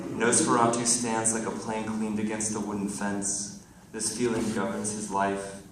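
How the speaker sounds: projected, deliberate verse delivery; poem reading. Acted. Poet reading to friends in the audience, projected recital. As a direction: recite it to the room in measured phrases.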